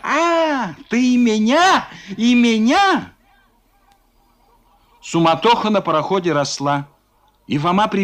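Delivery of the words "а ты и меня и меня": higher, louder indignant squeal; thin squeal. Acted. Zubov squeals back at Foma, high and shrill. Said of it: "завизжал тонким голосом".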